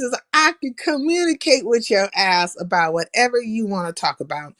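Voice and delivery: ordinary talk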